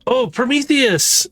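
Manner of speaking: high, drawn-out delighted cry; excited call. Acted. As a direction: call out to the ship, thrilled. Ash calls out to Prometheus.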